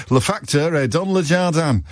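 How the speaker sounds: put-on French words said with a light Yorkshire accent; mock schoolboy reciting French in a Yorkshire accent; Yorkshire-accented French, lightly played. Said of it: "schoolboy French with Yorkshire accents".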